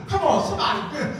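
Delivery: ordinary talk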